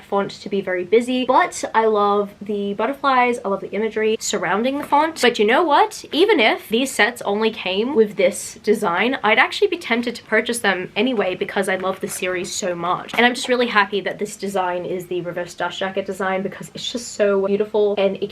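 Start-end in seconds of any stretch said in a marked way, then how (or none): none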